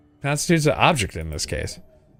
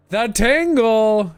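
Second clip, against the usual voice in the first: dramatic reveal; 8 semitones higher, much slower than usual. Acted. GM announcing magical item.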